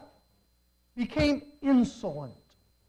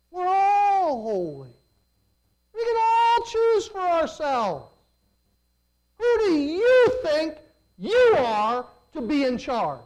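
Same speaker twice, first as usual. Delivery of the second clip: high, indignant protest; mocking rebels; 5 semitones higher, 3 dB louder than usual. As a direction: whine it up like a smug rebel. Rebels mock Moses's leadership.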